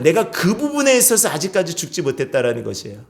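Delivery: normal talking voice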